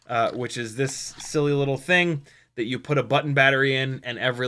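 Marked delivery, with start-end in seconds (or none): none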